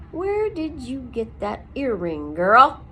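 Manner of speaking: slow question ending in a loud shout; shriek. A narrator's reading. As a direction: shriek the last word. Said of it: "he shrieked at Poppy".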